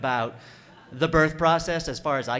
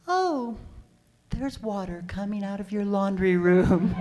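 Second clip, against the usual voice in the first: high, airy mock-unbothered midwife; oblivious midwife; 6 semitones higher than usual. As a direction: imitate her mild, high-pitched surprise.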